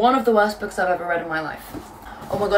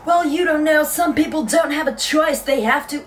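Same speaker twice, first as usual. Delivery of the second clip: higher, louder mocking American accent; imitating an American girl, raised and loud; 6 semitones higher, 4 dB louder than usual. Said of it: "I don't know why I'm giving her this accent".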